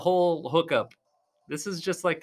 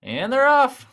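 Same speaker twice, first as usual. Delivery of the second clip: higher, louder start announcement; excited match-start call; 7 semitones higher, 6 dB louder, slower than usual. Real, not acted. Commentator calling the start of the match, excited. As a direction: kick the bout off, pitched up and loud.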